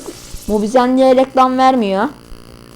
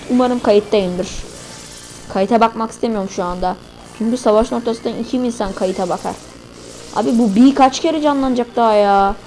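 usual speaking voice

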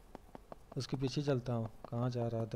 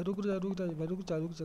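higher-pitched, repeated call to stop; urgent calling out; 6 semitones higher than usual. Real, not acted. Gamer calling a running teammate to wait, urgent higher call. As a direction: call after someone getting away from you.

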